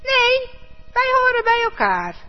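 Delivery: high, loud little pig putting her foot down; indignant pig. Acted. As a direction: insist firmly as the little pig. Varkentje insists, pig voice.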